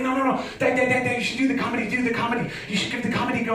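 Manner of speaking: high-pitched, quick mock nagging; insistent pestering friend. Friend pushing him to do comedy, insistently pestering. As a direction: keep badgering, words tumbling out high.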